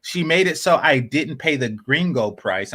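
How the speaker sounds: ordinary talk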